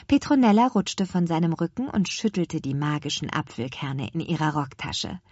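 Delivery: plain reading voice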